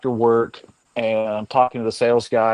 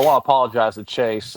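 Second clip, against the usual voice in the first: ordinary talk, nothing marked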